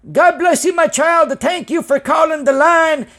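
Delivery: mock the phone preacher blessing him; imitating a preacher on the prayer line. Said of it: "it was like".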